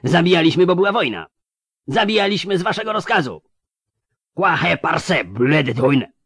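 high, loud furious yelling; furious shout. Acted. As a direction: scream the accusation back. Elves shout back in fury.

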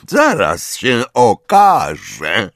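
very slow, menacing drawl; stretched-out teacher's sneer; drawled through teeth. A narrator's reading. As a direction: draw each word out with veiled threat. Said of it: "wycedził Snape".

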